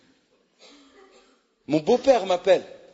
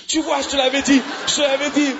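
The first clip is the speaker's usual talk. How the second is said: mock told-you-so shout; 7 semitones higher than usual. Wife scolding, shout heard.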